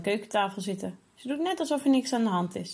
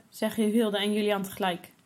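ordinary delivery

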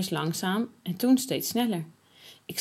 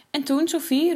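eager call; 6 semitones higher, 3 dB louder than usual. A narrator's reading. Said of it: "roept Klaas".

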